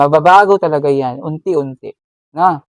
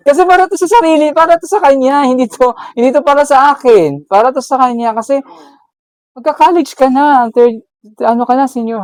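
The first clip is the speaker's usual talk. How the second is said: excited; 8 semitones higher, 4 dB louder than usual. Real, not acted.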